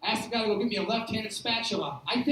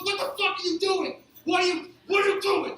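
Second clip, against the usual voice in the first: high-pitched, incredulous angry demand; mad; 7 semitones higher, 4 dB louder than usual. Acted. Angry manager confronts worker over the mess, mad. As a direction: demand an answer, voice raised high. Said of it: "Like, he's mad now".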